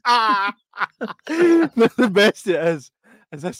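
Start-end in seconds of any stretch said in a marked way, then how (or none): 0.1-0.5 high yelp of shock, imitated; a sudden squeal of panic, retold; mock scream. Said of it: "And I'm like".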